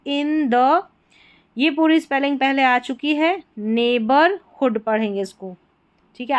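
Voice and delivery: normal talking voice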